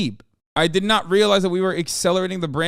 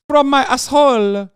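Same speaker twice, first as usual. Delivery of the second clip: hammed-up fake Italian accent, much higher and louder; 6 semitones higher, 6 dB louder than usual. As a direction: play an over-the-top Italian caricature. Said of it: "the fake Italian accent".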